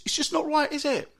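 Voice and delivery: ordinary delivery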